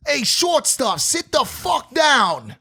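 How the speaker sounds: high mock heckler shout from behind; heckler yelling. Heckler behind them mocking Garcia, high taunting voice. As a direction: call out like a rowdy heckler.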